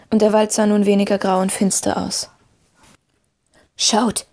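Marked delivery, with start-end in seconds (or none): none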